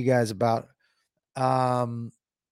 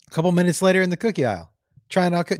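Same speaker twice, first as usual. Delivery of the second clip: ordinary delivery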